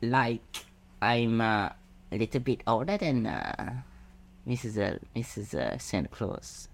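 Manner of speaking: lightly voiced girl elf. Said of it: "I'll be girl elf".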